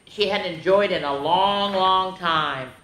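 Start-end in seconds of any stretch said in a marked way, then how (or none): none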